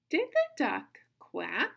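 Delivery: slow read-along, "quack" swinging up; question intonation. Shown as a demonstration. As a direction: read "Did the duck quack?" together, high, loud and questioning. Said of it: "sounds like there's a question mark there".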